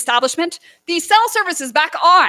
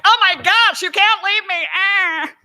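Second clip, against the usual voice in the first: a light mock of a clingy hubby, a bit higher and louder; half-hearted whiny plea from the husband; imitating hubby begging her not to leave; 5 semitones higher, 4 dB louder, slower than usual. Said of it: "He maybe didn't sound exactly like that".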